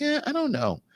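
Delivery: high, doubtful "eh, I don't know". Retold or imitated. Host imitates his first doubt about the merch, high and doubtful. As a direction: squeak out a doubtful shrug. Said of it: "At first, I was like".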